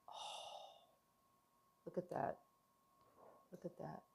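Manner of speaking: soft, awed murmur; hushed admiration. Real, not acted. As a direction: gaze at it and breathe it out. Admiring slice close to mic.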